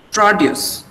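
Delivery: produce as PRO-duce; first-syllable stress. Shown as a demonstration. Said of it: "stress is on the first syllable".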